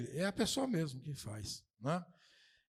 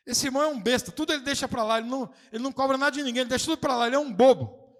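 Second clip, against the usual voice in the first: mocking scorn; 8 semitones higher, 8 dB louder, faster than usual. Mocking people who scorn the meek.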